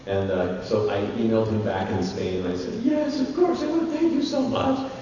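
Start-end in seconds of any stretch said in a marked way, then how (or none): 2.8-4.9 eager acceptance. Retold or imitated. Eager reply to director.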